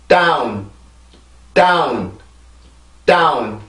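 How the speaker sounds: 다운 with a strong puffed d into a stressed vowel; stressed English down. Shown as a demonstration. Teacher modelling English down for students. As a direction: block the air then burst 다운. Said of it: "다시 한번".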